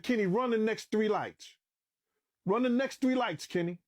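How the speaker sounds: high, insistent command to a driver; retold urgent order. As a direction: reenact pressing the driver to go.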